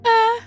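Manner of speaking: high short "ah"; quick high mock scream; imitated startled yelp. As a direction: mimic a yelp from another room. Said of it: "and I just hear".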